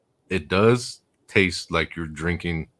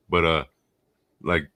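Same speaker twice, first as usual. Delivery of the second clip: usual speaking voice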